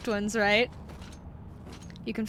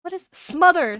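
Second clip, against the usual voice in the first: surprised realization; 7 semitones higher, 6 dB louder than usual. Real, not acted. Realizes the right word.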